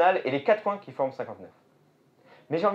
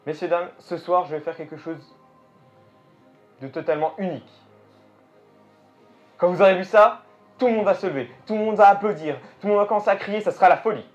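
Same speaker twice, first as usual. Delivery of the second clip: showman announcement; 3 semitones higher, 5 dB louder than usual. Pretend. Showman hyping the crowd.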